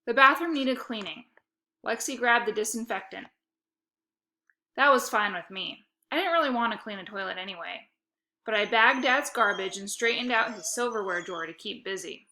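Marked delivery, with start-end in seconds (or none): none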